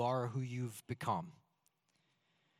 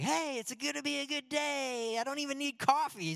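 bright, much higher chirpy voice; cheery morning person; 10 semitones higher than usual. Retold or imitated. Pastor mimics himself as a chipper early riser, much higher and perky. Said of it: "one of those weirdos that gets up and is like".

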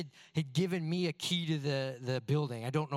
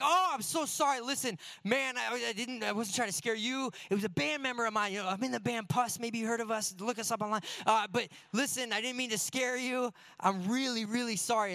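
embarrassed apology; 6 semitones higher than usual. Retold or imitated. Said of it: "I'm so embarrassed".

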